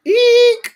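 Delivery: a high squeal of disapproval; a high cringing eek; shrill eek of distaste. Retold or imitated. Reaction to the musical.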